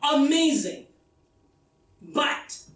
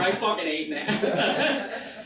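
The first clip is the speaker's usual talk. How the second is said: ordinary delivery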